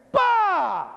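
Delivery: high, sudden "bah" falling in pitch; imitated explosion bang. Survivor recreating the blast at the top, imitated bang. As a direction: voice the blast as one high burst.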